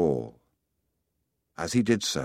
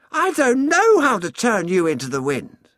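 the sun speaking, much higher and louder; lofty voice of the sun, pitched way up; warm beaming sun voice; 13 semitones higher, 7 dB louder than usual. Acted. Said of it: "the sun said".

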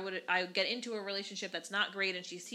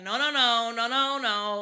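loud pleading no, over and over; urgent no; 2 semitones higher, 9 dB louder, slower than usual. Retold or imitated. Dad pulling mom away from the fight, loud urgent no. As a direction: stop her before she goes back, loudly. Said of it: "Your dad's like".

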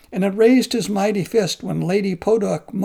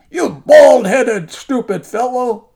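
roaring at the monk in fury, high and loud; an enraged giant shouting insults; raging giant; 5 semitones higher, 8 dB louder than usual. Acted. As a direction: bellow it like a furious demon. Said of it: "burst into a rage".

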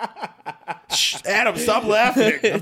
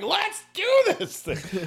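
pumped up; 13 semitones higher, slower than usual. Retold or imitated. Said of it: "was like".

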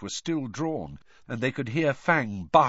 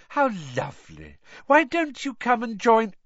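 high, gushing invitation; beaming invitation; 12 semitones higher, 5 dB louder than usual. A narrator's reading. Reporter invites Harry over, beaming. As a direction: gush with delight as you invite.

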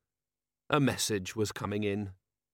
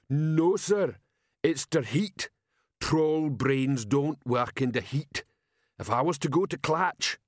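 dim troll, slightly higher; slow-witted troll sergeant; 4 semitones higher, slower than usual. Acted. Troll sergeant asking his commander, dim troll voice.

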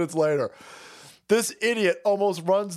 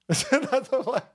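higher, words lost in laughing; breaking into laughter; 4 semitones higher, slower than usual. Real, not acted. Host laughing at own Billy Mays joke, cracking up.

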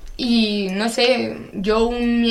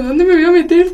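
higher, louder hammed-up tearful wail; mock crying wail; 7 semitones higher, 8 dB louder than usual. Teen recalls crying on the way to camp, mock wail. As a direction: play the crying teen over the top, mock wailing. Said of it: "yo lloraba diciendo".

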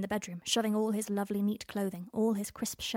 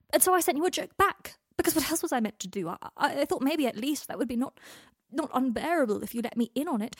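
frantic, agitated rant; 4 semitones higher, 4 dB louder than usual. Acted. Draco's frantic shouted rant.

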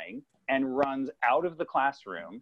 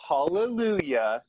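slow, raised hallelujah cry; mock-religious proclamation; 4 semitones higher, 4 dB louder, slower than usual. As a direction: imitate a devout boy praising heaven, drawn out. Boy proclaims lizard's miracle to the ceiling.

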